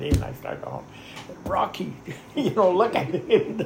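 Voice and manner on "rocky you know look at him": laughing, excited call to the pilot; higher, half-laughing alarm; retold excitement through laughter. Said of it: "I got all excited".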